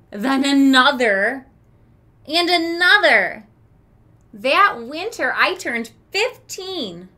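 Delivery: lively read-aloud for a class; expressive story narration. Acted. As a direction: read the story to the children with character. Teacher reads story to class.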